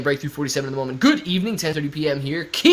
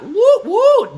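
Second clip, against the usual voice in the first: excited whoop; 8 dB louder than usual. Real, not acted. Cheering the stock on.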